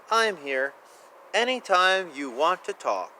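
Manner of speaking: plain reading voice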